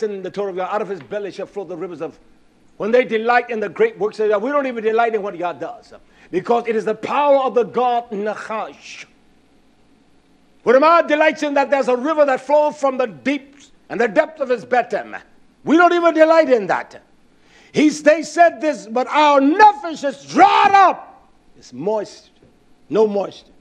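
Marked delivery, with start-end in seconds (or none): 2.8-18.9 high, loud preaching build; full-force preaching. Acted. 18.9-21.3 slow, loud whining cry; mock complaining shout.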